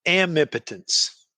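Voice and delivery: "amipotence" as am-IP-uh-tence, carefully sounded; coined word pronunciation. Shown as a demonstration. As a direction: say "amipotence" opening on "am", not "om". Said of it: "How do you say this word".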